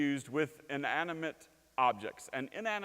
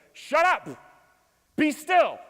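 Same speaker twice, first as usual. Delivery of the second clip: high, loud command to the lake; commanding shout; 12 semitones higher, 12 dB louder, slower than usual. Acted. Jesus ordering the lake to be still, loud shout. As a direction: shout the storm into silence.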